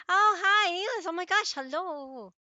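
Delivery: high, loud, delighted hello to a friend; light up and gush a greeting, stretching it slowly; excited greeting. Real, not acted. Greets a viewer who just arrived.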